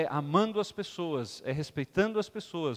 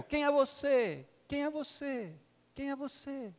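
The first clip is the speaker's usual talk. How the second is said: higher, softer and softer echo; 7 semitones higher, 5 dB quieter, slower than usual. Acted. The echo answers the boy, fading echo. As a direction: let each repeat fade away. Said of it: "E aí o eco".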